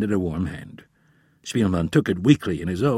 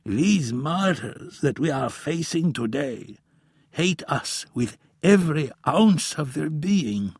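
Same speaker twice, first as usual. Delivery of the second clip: put-on higher, slow, solemn old man's voice; grave old professor; 7 semitones higher than usual. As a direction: warn like a weary elder.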